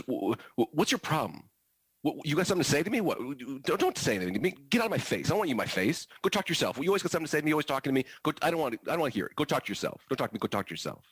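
acted agitated outburst, high-pitched and rapid-fire; high, worked-up mock tirade; worked-up student. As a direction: imitate a kid getting defensive, high and stammering. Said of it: "he starts to get a little worked up".

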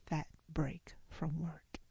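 a sly whispered ending; whispered punchline. Real, not acted. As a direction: let the last words drop to a whisper. Playful confiding ending.

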